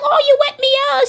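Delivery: put-on very high, quick little-boy protest; imitated complaining child. Boy tells his aunt she wet him, mock high-pitched protest.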